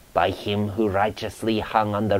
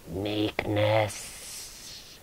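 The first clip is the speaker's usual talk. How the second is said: hollow soft ghost moan; ghostly moan; 9 dB quieter than usual. A narrator's reading. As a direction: moan it out like a spirit. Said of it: "it moaned".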